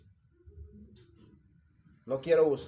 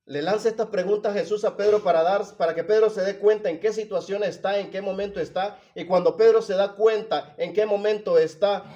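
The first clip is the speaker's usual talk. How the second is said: raised, higher-pitched sermon delivery; loud, urgent preacher in full flow; impassioned preaching; 7 semitones higher, 9 dB louder than usual. Real, not acted. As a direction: drive the explanation home to the congregation.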